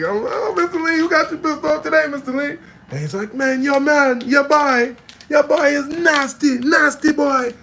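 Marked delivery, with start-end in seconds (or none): 0.0-2.6 high, loud, singling him out; excited teasing. Retold or imitated. Teasing the angry cook. 3.3-7.5 high, shouted Chinese Jamaican outrage; ranting Chinese Jamaican accent. Retold or imitated. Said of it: "he's going off one day".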